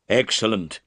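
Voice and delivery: plain reading voice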